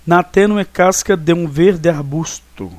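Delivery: slow, measured recitation of the verse; poetry teacher reciting the full verse for the class; whole verse recited. Shown as a demonstration. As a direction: read the line out evenly with its beats. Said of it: "Vamos pronunciar, então, todo o verso".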